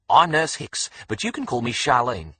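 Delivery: chirpy Aussie nurse introducing herself; broad Australian accent. A narrator's reading. Nurse greeting Alex, in a broad Australian accent. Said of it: "such a broad Australian accent".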